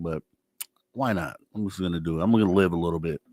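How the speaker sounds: ordinary delivery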